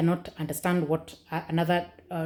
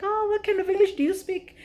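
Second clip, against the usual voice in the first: high mocking kid voice, much higher; 14 semitones higher than usual. Kids mocking her English in a high teasing voice. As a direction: tease like a schoolkid. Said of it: "the kids used to make fun of me".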